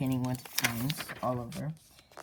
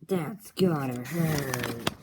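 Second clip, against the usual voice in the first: drawn-out, very slow that's gotta hurt; mock sympathetic slow drawl; slow wincing quip; 4 dB louder, much slower than usual. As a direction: stretch it out like a sarcastic wince. Tall guy comments.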